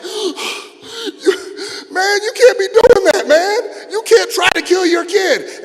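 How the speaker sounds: high, loud, gasping protest; outraged yell. Retold or imitated. Son just released by dad, acted outraged yell. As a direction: cry out in hammed-up disbelief. Son let up after being choked.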